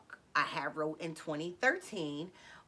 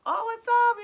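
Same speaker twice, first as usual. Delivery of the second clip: familiar greeting; 10 dB louder than usual. Retold or imitated. Woman greeting the two fellas showing up, familiar and loud. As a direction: greet them like old pals, high and loud. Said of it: "familiarity kick in".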